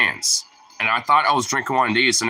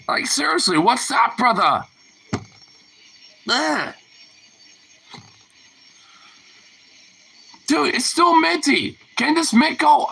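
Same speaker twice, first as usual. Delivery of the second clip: strained disgust; 13 semitones higher than usual. Real, not acted. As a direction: whine through the burning taste. Still suffering from the mint.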